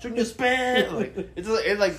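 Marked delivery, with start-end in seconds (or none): 0.0-0.9 imitated shout. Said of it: "eating chicken and shouting at each other".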